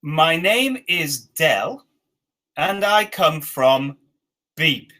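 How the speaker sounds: very slow, word by word; slow dictation. Shown as a demonstration. Teacher dictating the first sentence slowly. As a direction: space each word out for the learners. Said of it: "the first sentence at the top here is".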